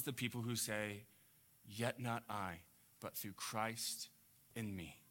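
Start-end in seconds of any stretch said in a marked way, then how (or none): 1.7-5.0 softer, lower, slow and gentle; hushed and reverent; tender quiet confession. Shown as a demonstration. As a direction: say it tenderly, almost to yourself. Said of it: "God loves his children".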